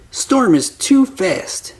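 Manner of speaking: plain reading voice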